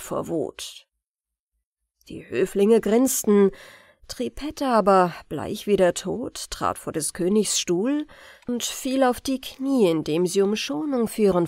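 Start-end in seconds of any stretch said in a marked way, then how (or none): none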